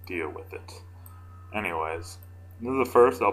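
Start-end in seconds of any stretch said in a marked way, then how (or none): 0.1-0.7 breathy aside whisper; whispered deadpan aside. Real, not acted. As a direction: drop to a whisper for the joke.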